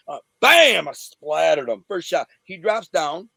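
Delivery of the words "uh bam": loud, high "bam"; imitated punch. Storyteller landing the first shot, loud imitated blow. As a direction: slam out the impact word.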